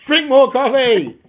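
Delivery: high, loud, slow teasing shout. Pretend. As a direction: call it out playfully. Joking loud advice.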